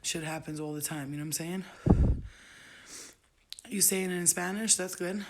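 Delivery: ordinary talk, nothing marked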